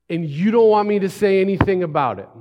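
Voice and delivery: loud, disbelieving protest from a healed man; incredulous protest. Retold or imitated. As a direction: push back hard, almost shouting.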